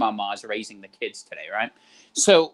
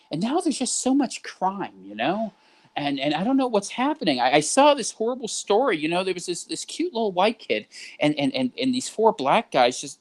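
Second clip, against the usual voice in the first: higher, fretful grandparent voice clucking about crime; boomer impression; 4 semitones higher than usual. Mimicking a boomer lamenting crime, higher fretful voice. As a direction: play a worried older boomer, pitched up. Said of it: "here's what it sounds like. Be like".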